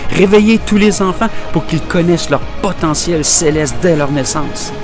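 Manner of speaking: high-pitched, urgent exhortation; fervent appeal. Acted. Educator pleading to awaken every child, fervent appeal. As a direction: keep rousing them with fervour. Continues the wake-up call.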